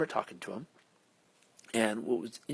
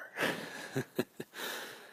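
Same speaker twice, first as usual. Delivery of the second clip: soft breathy laugh under the breath; whispered laugh; 3 dB quieter than usual. Real, not acted. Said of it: "so I'm gonna whisper".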